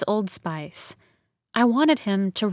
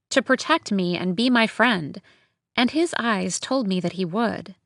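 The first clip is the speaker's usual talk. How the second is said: ordinary delivery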